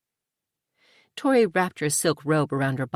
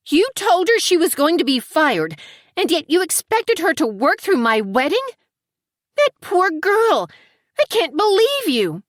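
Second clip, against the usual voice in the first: much higher, louder outraged cry; 13 semitones higher, 6 dB louder than usual. A narrator's reading. Tori to Amy over the firing, shrill outrage. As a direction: Tori explodes in shocked disbelief, slowing on the outcry.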